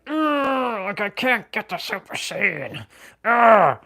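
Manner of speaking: high, strained groan of a stuck fighter; frustrated Vegeta. Retold or imitated. Vegeta straining for Super Saiyan, frustrated high groan. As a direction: whine it out like Vegeta unable to transform. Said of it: "what Vegeta had to do".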